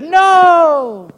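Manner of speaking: put-on high, loud little-boy voice saying no; emphatic kids. Sunday school boys answer the teacher, emphatic and high. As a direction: play the boys' firm answer. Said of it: "they emphatically said".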